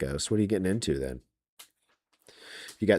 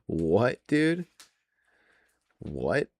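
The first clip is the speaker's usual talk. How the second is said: normal talking voice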